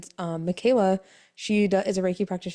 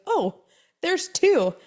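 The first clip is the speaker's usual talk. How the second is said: higher, rising to a louder "two"; casual announcement; 9 semitones higher, 3 dB louder, much slower than usual. Retold or imitated. Doctor at the ultrasound, higher and louder reveal. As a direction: drop the news lightly.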